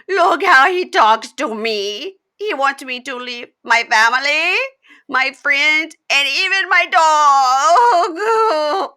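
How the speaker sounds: very slow, high, loud fake sobbing; hammed-up wail, stretching out dog; mock bawling. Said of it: "goes into full Jasmine, bawling".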